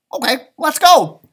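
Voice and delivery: high, loud eager voice; eager little bird. Acted. Pip eagerly agrees to meet the bat family. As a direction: jump at the chance. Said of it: "said Pip".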